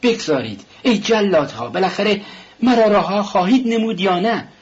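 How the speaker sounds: angry cry to be left alone. A narrator's reading. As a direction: drive them out, voice rising. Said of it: "راسکولنیکوف با عصبانیت گفت".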